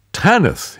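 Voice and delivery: surprised cry of her name, high and loud; startled recognition; exclaiming. Acted. Rex recognizes Tanith's voice, surprised exclamation. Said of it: "he exclaimed".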